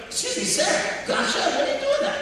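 very high, squeaky mock protest; put-on high-pitched disbelief; shocked objection. Latecomer questioning what he heard in shul, mock-shocked.

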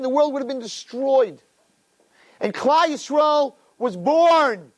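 high-pitched, ringing sermon delivery; impassioned preaching. Real, not acted. Preacher tells of the awaited nation's birth, high fervent cry.

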